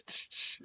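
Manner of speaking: two short hissed bursts; imitated shushing noise. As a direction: imitate a quick sharp "tsh" sound. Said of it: "he goes".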